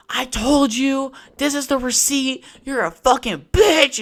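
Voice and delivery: high, slow furious yelling at a clerk; mock outraged rant; retold angry yelling. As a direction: chew her out, loud and high.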